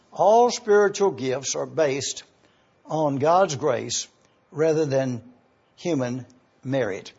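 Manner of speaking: slowed down, each phrase spaced out for note-taking; deliberate, slow dictation pace. Shown as a demonstration. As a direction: say it slowly so they can write it down. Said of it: "Let me repeat this for you to be able to get it down correctly".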